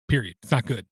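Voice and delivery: ordinary delivery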